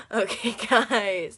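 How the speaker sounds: slow words through laughter; giggling apology to viewers; laughing. Real, not acted. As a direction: crack up and try to recover. Laughing at herself.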